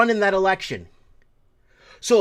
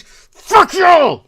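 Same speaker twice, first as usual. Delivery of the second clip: screamed much higher and louder, raging; furious scream; 11 semitones higher, 13 dB louder than usual. Real, not acted. Host furious about sanctions on his people, screaming. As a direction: let it all out in one enraged yell. Rant peaks after Portuguese sanctions.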